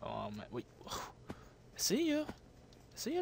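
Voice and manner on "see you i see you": soft, higher sing-song I see you; creepy quiet taunt. Real, not acted. Hunting an enemy player, a soft creepy taunt. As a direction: stalk them with a soft teasing voice.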